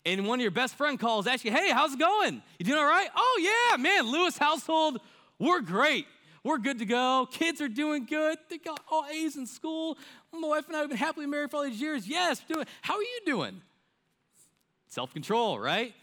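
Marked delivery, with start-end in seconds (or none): 1.5-3.1 high, loud bright phone greeting; cheery friend. Retold or imitated. 3.1-13.6 much higher, forced-happy phone voice; fake cheer. Fake cheer mid-fight on phone.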